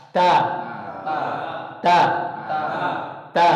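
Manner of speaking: ta, tongue tip released from the gum line; teacher releasing the tongue for ta; demo of ta. Shown as a demonstration. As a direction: release and say ta, one at a time. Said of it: "sambil kita lafadzkan ta".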